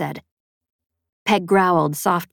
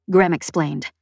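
ordinary talk, nothing marked

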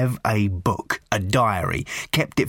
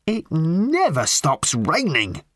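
slow, drawn-out rant with higher pitch; 6 semitones higher, slower than usual. A narrator's reading. Lorry driver complains about the endless rain, ranting. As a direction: rant it, stretching the words out. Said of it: "ranted the lorry driver".

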